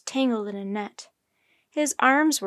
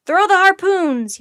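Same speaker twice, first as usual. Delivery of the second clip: high, very loud battle order; drawn-out forceful shout; yelling; 8 semitones higher, 12 dB louder than usual. A narrator's reading. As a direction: yell a command across the deck. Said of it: "yelled a sailor".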